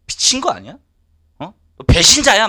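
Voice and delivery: 높고 크게 배신자라며 몰아세우기; 배신감에 버럭 소리치듯. Retold or imitated. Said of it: "막 이랬어". (교회 다녀온 아내에게 배신자라며 버럭 지르는 고함)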